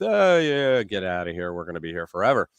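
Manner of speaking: ordinary talk, nothing marked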